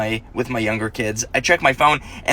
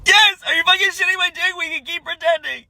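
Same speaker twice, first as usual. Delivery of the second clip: overjoyed. Retold or imitated. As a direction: burst out with happy relief. Dad overjoyed at daughter.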